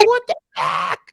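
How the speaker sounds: high, baffled; puzzled surprise. Retold or imitated. Sister baffled by the perfect hair, high-pitched. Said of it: "she's just like".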